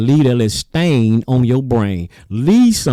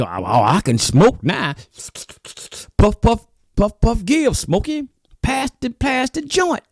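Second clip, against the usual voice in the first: high-pitched mock stoner, pleased with himself; giddy stoner; 10 semitones higher than usual. Preacher mocking churchgoers happy about legal weed, high giddy stoner voice. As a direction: play a giddy pothead passing it round. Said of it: "And you go".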